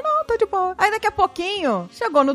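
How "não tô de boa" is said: a hint of forced cheer; fake-fine daughter. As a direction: brush off dad's worry. Daughter hiding sadness.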